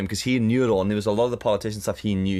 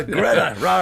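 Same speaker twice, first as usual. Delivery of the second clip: ordinary talk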